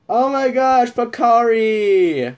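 high, drawn-out call of the name; excited call. Real, not acted. Recognising an old favourite, stretched call.

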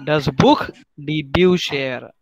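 slow: Buch with kh, Bücher with sh; teacher repeating the model; model pronunciation. Shown as a demonstration. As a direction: stretch out Das Buch die Bücher carefully. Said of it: "otherwise it is kh".

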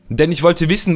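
ordinary delivery

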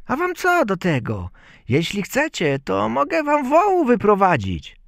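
high, cheeky little voice; Bździelik. A narrator's reading. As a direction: bargain with the thieves in a high child's voice. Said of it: "Bździelik, jakoś dopędził złodziei". (wysoki, rezolutny głosik malca)

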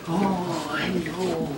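usual speaking voice

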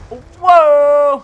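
high, very loud whoa; real startled shout; startled cry. Real, not acted. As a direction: yell out in sudden surprise. Startled by the game action.

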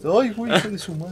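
startled high yell; panicking gamer. Real, not acted. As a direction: yelp in sudden game panic. Caught by the spider in game.

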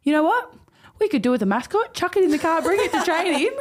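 coach's breezy idea. Retold or imitated. Coach on the phone suggests a mascot, high and cheery. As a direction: toss it off like a chirpy coach. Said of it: "He goes".

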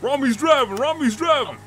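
high, loud excited cheer; hyped up, shouting with glee. Real, not acted. Hyped up as the chase begins.

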